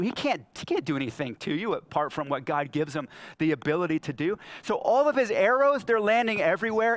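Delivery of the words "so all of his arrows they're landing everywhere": louder and higher, arrows flying everywhere; lively, pitched-up exclamation; animated telling. Real, not acted. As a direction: raise pitch and volume in excited storytelling.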